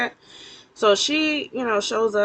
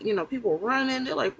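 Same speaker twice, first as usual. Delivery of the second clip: ordinary talk, nothing marked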